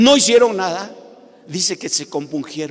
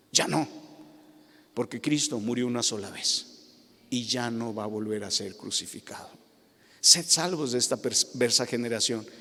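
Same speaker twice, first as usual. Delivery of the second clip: ordinary talk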